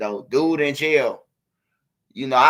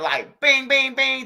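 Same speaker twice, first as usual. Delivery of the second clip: sharp, higher bings like bullets striking metal; gunshot imitation; 7 semitones higher, 4 dB louder, slower than usual. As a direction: spit out each bing like a ricochet. Imitating gunshots hitting poles.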